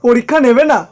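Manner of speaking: loud, disbelieving shout; shocked shout. Acted. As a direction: blurt it out in shock. Shocked reaction to cancelled exam.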